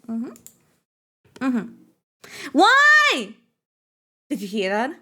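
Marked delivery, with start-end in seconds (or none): 2.5-3.3 high, loud wailing why; mock wail. Streamer hamming up a cry after the bonk. Reacts to a sound.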